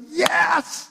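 high, bright burst of delight; Jesus thrilled at her faith; delighted yes. Acted.

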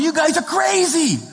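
high-pitched drawn-out mocking yell; hammed-up taunt from a passing car, slow and high; retold yell. Young people yelling at the preachers from a speeding car, mock high yell.

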